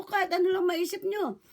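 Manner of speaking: ordinary talk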